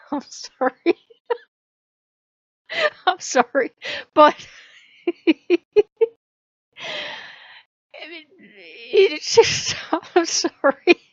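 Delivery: giggling apology, breaking up; can't stop laughing while apologizing; real laughing. Real, not acted. Vlogger cracking up over hippie Odin articles, laughing.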